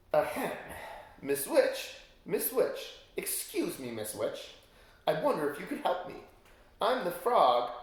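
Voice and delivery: a polite frog prince pleading for help; timid prince calling out politely. Acted.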